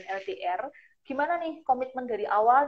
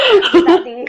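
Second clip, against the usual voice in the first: loud burst of laughter; laughing out the words; 8 semitones higher, 17 dB louder, slower than usual. Real, not acted. Laughing mid-question on live.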